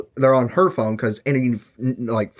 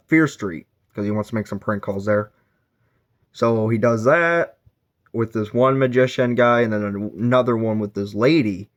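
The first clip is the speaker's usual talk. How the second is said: normal talking voice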